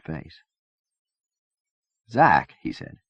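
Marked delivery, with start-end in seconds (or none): 2.1-2.6 calling his name loudly; urgent call to wake him. Acted. Trying to rouse fading friend.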